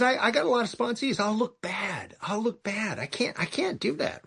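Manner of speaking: high, small-voiced protest; mock panicky whining. Imitates own panicky excuse about image.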